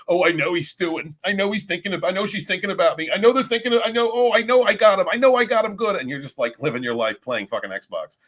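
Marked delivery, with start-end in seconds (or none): 0.1-6.0 lightly voiced smug narc, higher and rising to louder; a hint of a self-satisfied stalker, pitched up; gloating narcissist mock voice. Mocking the narcissist stewing in a corner, higher smug gloat. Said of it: "Let them think".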